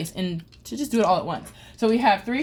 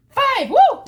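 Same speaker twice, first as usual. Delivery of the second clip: much higher and louder, a triumphant cheer; excited whoop; 8 dB louder than usual. Real, not acted. Rolling the five she begged for, triumphant whoop. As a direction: shout it out in victory. Finally rolls the needed five.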